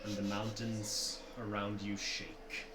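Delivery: slow, soft ominous narration. A narrator's reading. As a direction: build dread slowly. Game master's scene setting.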